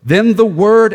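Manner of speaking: "word" hit high and loud; stress on word. Shown as a demonstration. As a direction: punch "word" in the reading. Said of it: "my emphasis is gonna be on the word".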